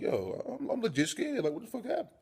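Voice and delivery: high-pitched mock panic; acted scared voice, jumping high. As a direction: squeak it out like a frightened big dude. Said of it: "I'm scared as fuck right now".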